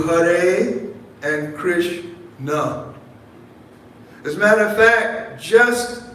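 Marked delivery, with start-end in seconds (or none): 0.0-2.9 Hare and Krishna drawn out very slowly; slow, deliberate. Shown as a demonstration. Preacher urging listeners to attend to each word, very slow. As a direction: linger over Hare and over Krishna. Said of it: "pay strict attention to".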